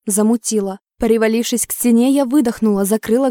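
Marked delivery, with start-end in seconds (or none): none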